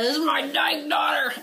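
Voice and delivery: high, loud hammed-up proud mom; mock cheer of a proud mother; mock proud announcement. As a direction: shout it like a proud parade mom.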